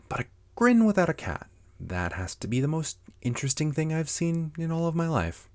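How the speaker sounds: high-pitched wondering exclamation; amazed Alice. Acted. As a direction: marvel aloud as an astonished storybook girl. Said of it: "thought Alice".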